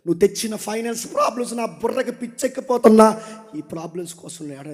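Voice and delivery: ordinary delivery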